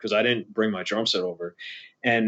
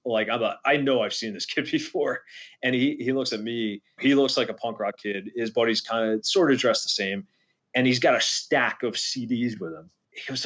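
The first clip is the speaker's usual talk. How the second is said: ordinary talk, nothing marked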